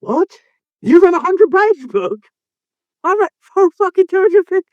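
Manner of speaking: very high-pitched incredulous outburst; outraged disbelief. Real, not acted. Host learns co-host read a shorter book, incredulous. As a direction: squeak it out high, appalled.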